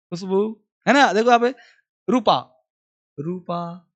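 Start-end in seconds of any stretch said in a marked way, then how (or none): 2.1-3.9 रूपा with रू held long; long u demo. Shown as a demonstration. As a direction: stretch the रू in रूपा. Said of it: "रू sound based है". (रूपा में रू लंबा)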